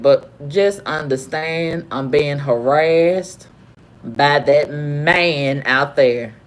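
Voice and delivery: very slow, low, deliberate delivery; measured complaint. Retold or imitated. Guest making the desk man understand she is harassed, slow and firm.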